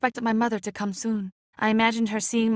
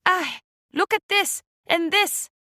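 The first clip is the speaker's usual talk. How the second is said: higher, slow, delighted gasp of wonder; happy disbelief; 7 semitones higher, slower than usual. Acted. Banquet guests admiring the dishes, in happy disbelief. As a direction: marvel at the food, voice lifted high. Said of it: "cried in happy disbelief".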